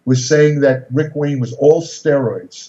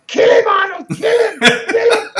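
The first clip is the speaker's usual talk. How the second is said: high-pitched excited yell, egging on a fighter; frantic high shout imitating a friend; slower than usual. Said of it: "Franco's yelling out".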